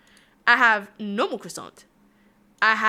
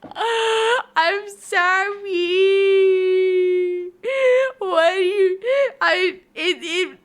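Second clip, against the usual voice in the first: squealing, high-pitched laughter through the words; can barely get the words out for laughing; real helpless giggling while apologising; laughing fit; 9 semitones higher, 6 dB louder, much slower than usual. Real, not acted. Cracking up at chat's quote.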